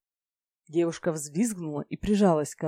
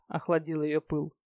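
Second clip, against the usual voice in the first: ordinary delivery, nothing marked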